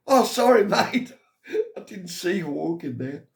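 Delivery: squeaky hammed-up apology laughing through it; mock apology. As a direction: play the cheeky innocent prankster. Mimicking Eddie's fake apology.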